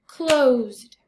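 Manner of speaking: "Closed" as one loud, firm beat; tapped-out word. Shown as a demonstration. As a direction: tap out "Closed" in a single strong falling syllable. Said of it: "tap out the vowel sounds".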